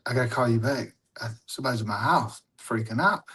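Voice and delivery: low, soft hurried murmur; hushed. Retold or imitated. Telling girlfriend to hang up, low hushed voice. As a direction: keep it down so parents don't hear. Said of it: "I'm being real quiet".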